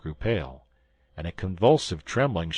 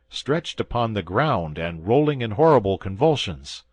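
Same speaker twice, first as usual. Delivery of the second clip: usual speaking voice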